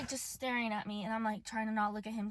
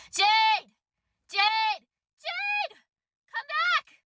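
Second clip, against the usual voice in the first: calling out a name, much higher and louder; mock him shouting up at the bedroom window; imitating his yelling; 10 semitones higher, 8 dB louder, much slower than usual. Retelling the date calling her name from outside, mock yelling. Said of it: "He starts yelling my name".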